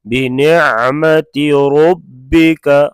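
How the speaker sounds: slow demo of रब्बिका; heavy ra. Shown as a demonstration. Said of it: "रा को पुर पढ़ा जाएगा हमेशा मोटा".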